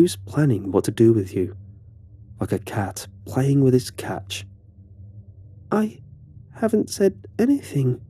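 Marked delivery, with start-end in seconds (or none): 5.7-8.0 high, small, cracking with fear; frightened murmur. Acted. Harisha answers the bully in a high, frightened murmur. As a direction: barely get the words out, terrified. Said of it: "murmured, her voice cracking with fear".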